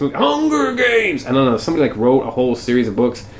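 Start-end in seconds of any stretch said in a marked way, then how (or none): 0.2-1.3 very high, stretched-out title cry; mock dramatic cry. Podcaster heckling a rip-off film, mock dramatic cry. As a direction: sustain it high like a hammed-up proclamation.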